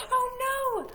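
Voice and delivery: high-pitched oh no; mock alarm. Storyteller pretends to panic at the big dinosaur, high alarm. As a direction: squeal it in pretend fright.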